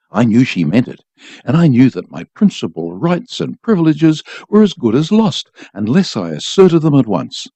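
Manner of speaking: ordinary delivery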